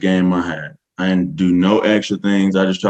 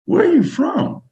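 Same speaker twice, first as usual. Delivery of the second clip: much higher, amazed where-are-you-from; stunned question. Retold or imitated. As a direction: ask it like you can't believe your ears. Stunned by her strong accent.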